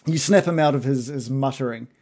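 ordinary talk, nothing marked

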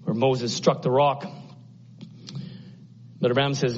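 ordinary talk, nothing marked

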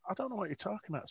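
quick, high-pitched retold protest; flustered denial. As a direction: re-enact a hurried plea of ignorance. Younger self protesting ignorance.

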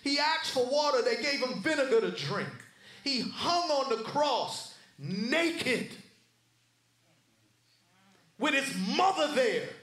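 forceful pulpit storytelling, leaning on key words; impassioned preaching. Real, not acted. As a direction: preach each indignity with rising force.

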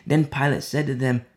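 usual speaking voice

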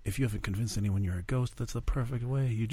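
soft, hushed aside; whispering. Real, not acted. Friend advising a pretend ghost on the plan, whispering. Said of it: "That's why I'm whispering".

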